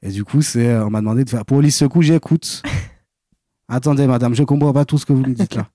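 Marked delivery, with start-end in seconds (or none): 1.5-5.7 higher, lively Antillean accent; emergency operator with a put-on Creole accent. Shown as a demonstration. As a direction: play the call-taker in Antillean French. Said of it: "de parler avec l'accent créole".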